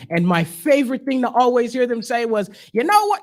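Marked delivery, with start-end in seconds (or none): 2.7-3.2 high, loud mocking Southern bigot voice; mock Southern accent. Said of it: "Excuse me if I do the accent".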